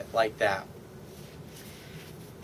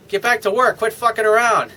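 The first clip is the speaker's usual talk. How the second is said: playful bossing; 7 semitones higher, 5 dB louder than usual. Shown as a demonstration. Teasing the busy bartender.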